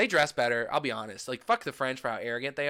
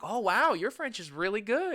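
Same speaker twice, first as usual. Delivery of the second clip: impressed local; 7 semitones higher than usual. Retold or imitated. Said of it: "they're like".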